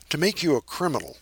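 usual speaking voice

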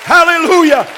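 high, loud, shouted preacher's plea; full-force preaching prayer. Real, not acted. Preacher praying for a healing station, full-force shouted preaching.

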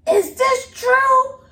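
put-on high, slow, tearful child voice; high tearful kids asking; kids' voice. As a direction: voice the kids, upset and near tears. Kids tearfully confronting mom.

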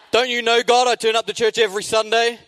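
high, loud mock outrage at God; angry complaint. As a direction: plead your case indignantly to heaven. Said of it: "I was angry, I was negative".

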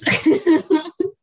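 a loud, rising laugh; laughing at her home office being next to the living room; burst of high laughter. Real, not acted. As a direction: laugh out at the absurdity.

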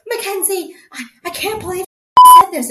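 high, loud mock outraged caller; mock outrage on the phone; angry caller. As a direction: play the upset caller, squealing high. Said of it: "someone calling you and is like".

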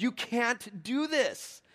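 imitating an outraged coach, drawn out; retold angry coach. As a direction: spell it out like an indignant rival coach. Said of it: "is angry".

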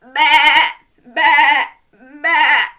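loud, high, wobbly bleats; sheep bleat. Acted. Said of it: "join in with all of these".

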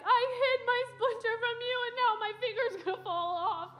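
high, loud tearful confession; mock crying. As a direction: wail it like an ashamed child, hammed-up. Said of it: "like, crying".

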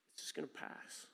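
hushed, whispered reassurance; soft whisper that it will pass. Real, not acted. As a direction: breathe it out gently, letting it go. Soft aside in sermon.

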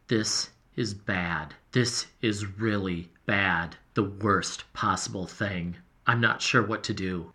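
low, soft, slow and heavy; like the news is sinking in; dejected. A narrator's reading. Journal writer confessing a loss to his diary, low and dejected. Journal entry after a disaster.

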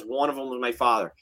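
usual speaking voice

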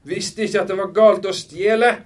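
loud, high, mocking echo; scornful. A narrator's reading. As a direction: throw it back as an incredulous question. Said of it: "Han så hånlig på henne".

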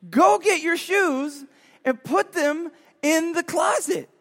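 high, slow, spelled-out command; exasperated parent. Retold or imitated. Parents to son, slow and high. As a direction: drag out each word in exasperation.

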